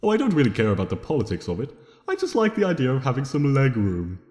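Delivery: plain reading voice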